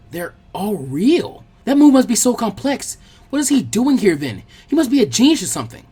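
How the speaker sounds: stunned, then gushing; amazed disbelief. Acted. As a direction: marvel at the clones. Stunned at the shadow clones.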